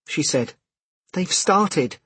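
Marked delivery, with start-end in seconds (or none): 1.1-2.0 high, urgent woman's voice; anxious woman. A narrator's reading. Woman to late arrival as exam begins, high and anxious. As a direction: tell him urgently, pitched up.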